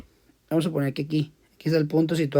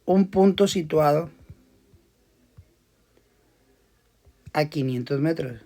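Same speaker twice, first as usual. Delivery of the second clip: slow, spaced reading of a word problem; teacher dictating a physics problem to students; slow dictation; slower than usual. Shown as a demonstration. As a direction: dictate each phrase with long pauses.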